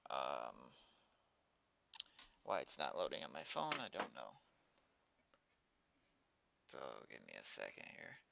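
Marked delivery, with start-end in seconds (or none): none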